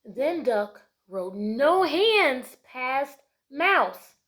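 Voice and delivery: loud and very slow read-aloud; dramatic picture book narration; slow storybook narration. A narrator's reading. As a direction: stretch each word for the kids.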